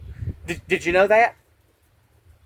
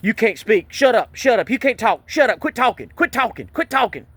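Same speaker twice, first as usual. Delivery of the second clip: higher, clipped mock barks of shut up; mimicking a yelling cop; 5 semitones higher than usual. Man mimicking the cop who yelled at him to shut up, raised mock yell. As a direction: imitate the cop snapping orders over and over. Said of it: "This cop yelled at me and said".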